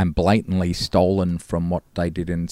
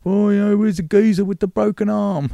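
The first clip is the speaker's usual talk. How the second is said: calling out; 12 semitones higher, 5 dB louder than usual. Retold or imitated. Band member calling out after show.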